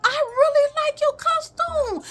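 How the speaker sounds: high, slow, sing-song praise; gushing lady. Retold or imitated. Lady at the door praising the costume, high and gushing. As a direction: gush over the child like a sweet lady.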